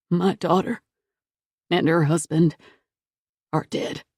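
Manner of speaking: mild tearful, choked voice fading out; strangled with tears. A narrator's reading. Grieving grandfather tells the sheriff, strangled with tears. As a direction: choke the words through tears. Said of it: "his voice strangled with tears".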